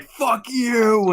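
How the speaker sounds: high-pitched acted curse; mock furious outburst. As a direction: hurl it as a hammed-up battle cry. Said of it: "no, he's like".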